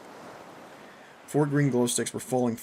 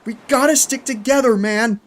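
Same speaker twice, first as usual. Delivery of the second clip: high, urgent, full-voiced shout; yelling; 12 semitones higher, 13 dB louder than usual. A narrator's reading. Narrator pleading with fleeing Bo, yelling. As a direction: shout after him urgently. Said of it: "I yelled".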